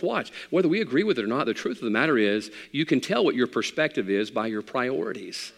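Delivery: ordinary talk, nothing marked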